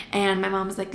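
usual speaking voice

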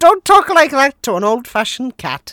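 loud, high hammed-up blowhard; pompous cartoon voice. Podcaster doing a cartoon captain, loud hammed-up blowhard. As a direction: boom it out like a vain old captain. Mock Brannigan-style quote.

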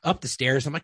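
ordinary talk

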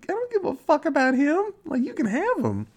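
put-on high, incredulous girly voice; mocking Tree's outrage. Voicing Tree scoffing at her roommate, in a high incredulous voice. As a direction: squeal it in disbelief.

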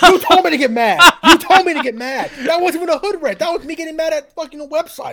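high, loud, amused indignation; laughing protest. Real, not acted. Guest fined for ranting defends himself, laughing protest.

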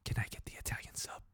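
barely audible whisper, breathy and unvoiced; imitated timid whisper. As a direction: whisper the order as if scared to be heard. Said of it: "Honey, I can't hear you".

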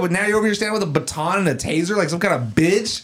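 heated, high-pitched replay of an argument; retold angry challenge. Retold fight challenge to guard.

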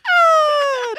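very high yelp on a pull-up; mock strained cry. Imitating Shoresy straining at pull-ups, high yelp. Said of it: "He's like".

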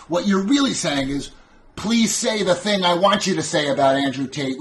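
loud, high-pitched exasperated rant; sassy. Real, not acted. Streamer fed up with Andrew Tate questions, sassy. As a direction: fire back indignant questions, pitch pushed up. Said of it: "I am sassy".

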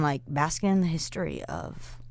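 soft whispered aside; whispering. Acted. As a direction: breathe it out as a whisper. Dreamy aside about history.

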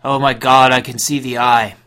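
loud ecstatic cult shout. Acted. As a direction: cry out in religious rapture.

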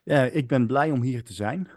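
proper native Amersfoort Dutch; Amersfoort accent. Shown as a demonstration. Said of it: "in a proper Amersfoort accent".

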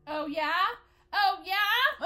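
acting out picking a fight, escalating; mock confrontational challenge. Acting out her challenge in quarrel.

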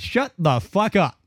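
slow, mock yell at the nurses; retold yell. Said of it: "it was loud in my room".

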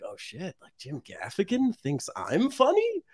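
amazed his idol rates him, rising to a squeal; mock disbelief. Telling friends about the idol's praise, rising amazed squeal. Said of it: "it was totally like".

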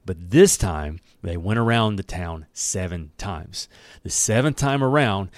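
usual speaking voice

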